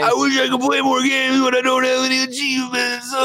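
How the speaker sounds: high, loud mock-crying complaint; Snowbike Mike. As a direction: wail it like a hammed-up sad gamer. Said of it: "That's him over there".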